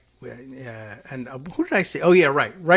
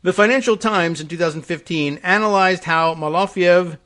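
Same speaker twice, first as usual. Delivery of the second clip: louder, emphatic reading voice; article read-aloud; 5 semitones higher, 9 dB louder than usual. Real, not acted. Host reading a report to listeners, emphatic read-aloud.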